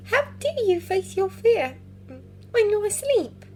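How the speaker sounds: put-on young girl voice. Lyra voiced.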